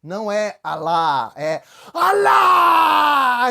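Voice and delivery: an angry shout correcting how "Alá" is said; furious correction. Retold or imitated. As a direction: bellow the second "Alá!" in a rage. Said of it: "o muçulmano ficou com raiva".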